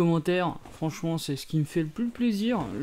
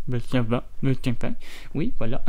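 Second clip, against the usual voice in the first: lower-pitched, badly faked Homer Simpson; bad Homer impression; 6 semitones lower than usual. Guest Homer answering the TV host, lightly voiced. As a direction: do a deliberately poor Homer. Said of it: "Imitation d'Homer, super nulle".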